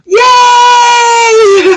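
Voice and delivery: very high, loud joyful yay; excited cheer. Real, not acted. Cheering for the viewer.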